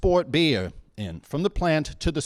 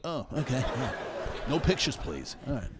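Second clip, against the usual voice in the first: dad playing a celebrity; 4 semitones lower, 7 dB quieter than usual. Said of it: "He'd just walk in the door like".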